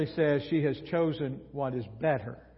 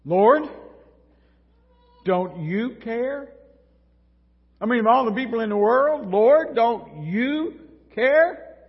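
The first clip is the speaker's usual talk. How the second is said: loud, pleading complaint, higher and louder; frustrated plea; 6 semitones higher, 8 dB louder than usual. Acted. Martha complaining to Jesus about her sister, loud and frustrated. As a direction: cry out to the Lord, fed up and hurt. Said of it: "Listen to her words".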